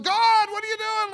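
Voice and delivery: very high, loud exasperated plea; hammed-up Moses. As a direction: plead with God in mock exasperation. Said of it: "Moses is going".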